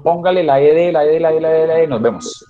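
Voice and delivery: retahíla aguda y recalcada, imitando al profe; profesor de colegio imitado. Retold or imitated. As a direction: imita al profe soltando la regla en cadena. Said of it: "en el colegio les dijeron". (imitación del profesor de colegio, repetitiva y aguda)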